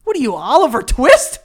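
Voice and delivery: high, loud voice breaking with laughter; crying laughing. Real, not acted. Friend mocking his Oliver Twist phrase, laughing hard. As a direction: tease him while helpless with laughter.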